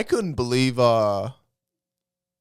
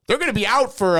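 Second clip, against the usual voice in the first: Chicago accent impression; 10 semitones higher, 4 dB louder than usual. Quoting electrician, high.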